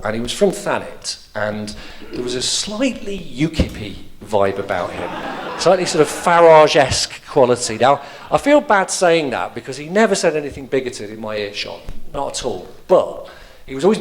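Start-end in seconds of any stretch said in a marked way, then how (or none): none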